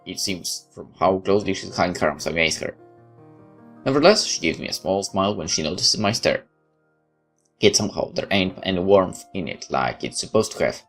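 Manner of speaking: ordinary talk, nothing marked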